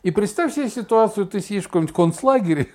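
ordinary talk

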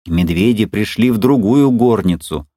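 ordinary delivery, nothing marked

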